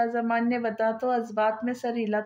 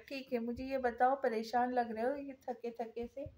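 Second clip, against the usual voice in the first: serious, softer question; 10 dB quieter than usual. A narrator's reading. Iman asks Shahzaman why he looks worried and tired, serious. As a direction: ask with quiet seriousness. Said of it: "ईमान संजीदगी से बोली".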